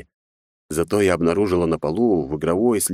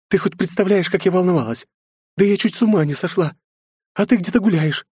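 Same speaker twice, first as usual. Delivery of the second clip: high distraught scolding; upset scolding; 13 semitones higher than usual. A narrator's reading. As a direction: scold him out of fear. Mother scolds runaway son. (взволнованный упрёк)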